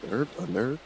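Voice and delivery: usual speaking voice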